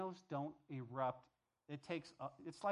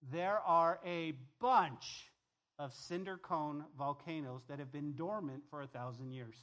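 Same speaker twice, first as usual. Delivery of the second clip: ordinary talk, nothing marked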